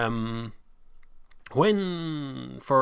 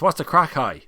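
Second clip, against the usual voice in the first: put-on Northern Irish accent; 7 semitones higher, 9 dB louder than usual. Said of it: "we all know what that accent is".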